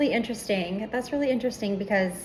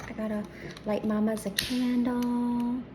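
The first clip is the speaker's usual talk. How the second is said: usual speaking voice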